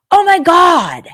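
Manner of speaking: high, loud "oh my God" after a gasp; gasp, then squeal it in surprise; imitated gasp of recognition. The bartender recognizing her at last, a high loud gasped "oh my God".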